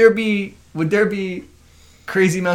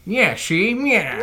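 hammed-up forties reporter voice with swooping pitch; old newsreel-style tough talker, played for laughs; old-time reporter; 5 semitones higher, much slower than usual. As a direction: put on a 1940s buddy-cop movie voice. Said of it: "that's a reporter voice more than cop voice".

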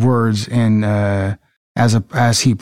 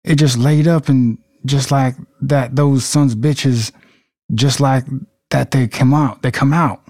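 acted Southern US voice; Southern accent. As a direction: say it like a Mississippi local. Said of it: "practice with our Southern accents".

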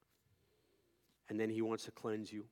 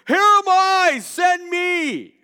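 very high, loud eager cry; excited; 20 dB louder, much slower than usual. Retold or imitated. Isaiah answering God, crying out with excitement. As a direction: shout it out, ready to go. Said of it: "he's filled with excitement".

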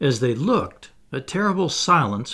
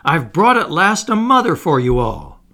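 loud, raised, proud proclamation; ringing announcement to the whole group; excited cry; 4 semitones higher, 8 dB louder than usual. A narrator's reading. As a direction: proclaim the glorious tidings. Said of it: "he cried".

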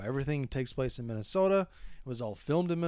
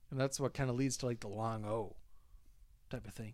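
ordinary talk

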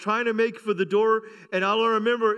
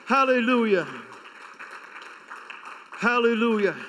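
raised, fervent preaching voice; 3 semitones higher than usual. Acted. Pastor preaching to his church, fervent and raised. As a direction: rouse the congregation, full force.

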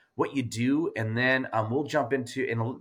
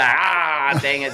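loud, frustrated groan at a slip; exasperated outburst; 3 semitones higher, 9 dB louder, much slower than usual. Real, not acted. Host after botching the guest's name, exasperated outburst. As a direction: burst out loudly, annoyed at yourself.

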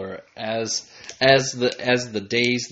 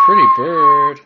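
much higher, louder squawking parrot; 11 dB louder than usual. Pretend. Parrot imitation.

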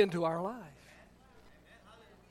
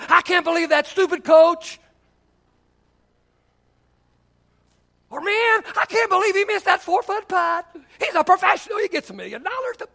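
armchair critic; 6 semitones higher than usual. Retold or imitated. Mocking armchair sports fan.

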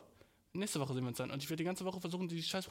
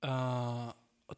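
ordinary delivery

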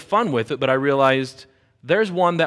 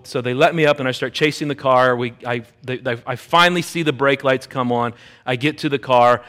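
ordinary delivery

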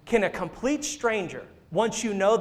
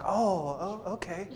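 soft, hesitant mock reply; taken aback; 9 dB quieter, slower than usual. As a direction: trail off quietly, imitating someone thrown. Voices the man taken aback.

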